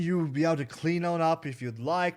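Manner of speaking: high, loud, eager innkeeper. Acted. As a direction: welcome guests like a beaming host. Franco greeting the party.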